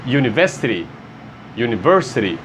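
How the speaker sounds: University begun with a Y sound; English pronunciation. Shown as a demonstration. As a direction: open University with a Y glide. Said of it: "Som de Y". (University com som de Y no início)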